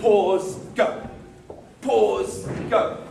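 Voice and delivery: loud Pause, a gap, then go; stop and go. Shown as a demonstration. Teacher drilling the class on pauses, stop and go. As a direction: punch Pause, wait, release go. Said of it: "without a pause".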